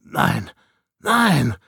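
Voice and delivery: stammered refusal. A narrator's reading. Snow refusing the question about Jiberian, stammering. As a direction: stammer it, then burst out. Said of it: "stotterte er".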